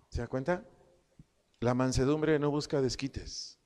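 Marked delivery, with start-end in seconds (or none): none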